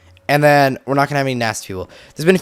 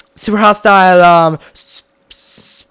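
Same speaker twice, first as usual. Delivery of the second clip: normal talking voice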